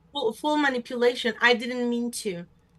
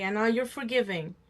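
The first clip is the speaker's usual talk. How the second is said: ordinary talk, nothing marked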